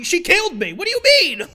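high, loud screech of protest; outraged high-pitched shout; indignant yelling. Real, not acted. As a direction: yell it in disbelief, voice shooting up. Yells back at an accusation.

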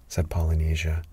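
ordinary delivery, nothing marked

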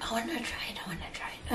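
whispering. Retold or imitated. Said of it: "So I was like".

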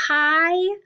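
HIGH drawn out, rising high in pitch; HIGH lifted and held long; stretched-up high. Shown as a demonstration. Stretching up on the word.

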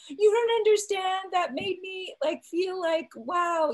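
high, loud overwhelmed gush; worked-up, high-pitched mock tearful plea; retold tearful outburst. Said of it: "she starts crying, I start crying".

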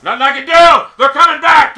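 loud cry of helpless frustration; alarmed shout. Real, not acted. Gamer watching them come back, alarmed shout. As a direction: shout it as the lead slips away. Player panicking as rivals catch up.

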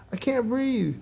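high, squeezed-out complaint; yelped. A narrator's reading. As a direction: pipe it up high, as if squashed. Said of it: "he yelped".

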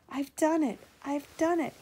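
high, loud triumphant puffing. A narrator's reading. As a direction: crow it out after the climb. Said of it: "he puffed triumphantly".

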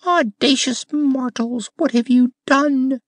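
very high, loud indignant rose cry; an outraged rose scolding the mortals; cried aloud. A narrator's reading. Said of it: "one of the roses cried aloud".

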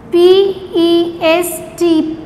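letters spelt out slowly one by one; slow spelling drill. Shown as a demonstration. Teacher spelling a word slowly for children to repeat. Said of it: "You have to repeat every word twice with me".

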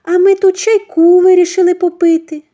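very high, loud, sugary cover-up; fake innocent. Mother-in-law covering up with fake sweetness. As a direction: gush to hide the guilt.

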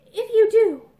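trembling, loud catch on the words; tremulous. Acted. Girl answering Mickey's confession, tremulous. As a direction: let it quaver out. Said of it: "she said tremulously".

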